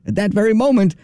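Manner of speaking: ordinary talk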